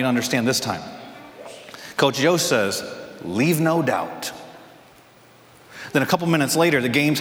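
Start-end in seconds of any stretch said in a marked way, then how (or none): 3.2-4.3 slow deliberate. Retold or imitated. Coach's quiet key line.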